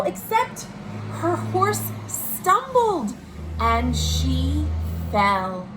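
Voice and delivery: lively read-aloud storytelling voice; storybook reading for children; story narration. A narrator's reading. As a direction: read it like a bedtime picture book to kids.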